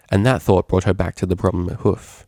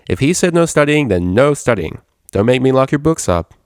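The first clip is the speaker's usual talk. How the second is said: firm, raised, teasing order; playful stern warning; 6 semitones higher, 5 dB louder than usual. Acted. Roommate forbids studying, playfully stern and loud. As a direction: lay down the law half-joking.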